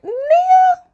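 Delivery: high, loud disbelief; shocked mock retort to Nia; incredulous. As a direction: ask her if she's lost her mind.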